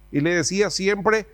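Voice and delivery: usual speaking voice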